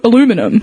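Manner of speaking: aluminum as "a-LOO-mi-num"; aluminum, four syllables, no "ee" sound; American pronunciation. Shown as a demonstration. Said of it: "So, like, Americans say".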